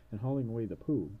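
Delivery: ordinary talk, nothing marked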